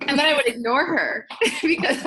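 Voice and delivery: laughing through a sheepish confession. Real, not acted. Confessing to interviewer she ignored Lisa, laughing.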